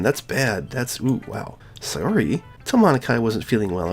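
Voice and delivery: ordinary talk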